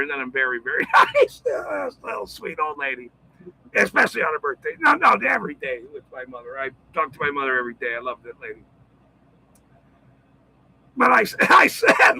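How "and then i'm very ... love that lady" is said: quiet, fond, softened voice; gentle quick murmur of love for his mother; tender about mom. Real, not acted. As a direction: go soft speaking of your mother.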